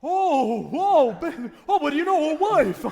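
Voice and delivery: loud, high, laughing whoop of surprise; a joyful shout at the news; delighted surprise. Acted. Uncle cheering his nephew's new wife, loud and delighted.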